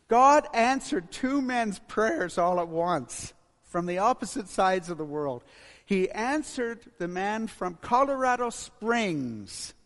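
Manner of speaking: loud, high, slow proclamation; preaching at full force. Real, not acted. Preacher proclaims God's answer to two men's prayers, full-force preaching.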